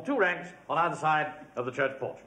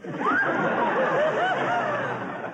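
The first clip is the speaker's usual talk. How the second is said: hearty laugh. Real, not acted. Amused at sergeant's excuse.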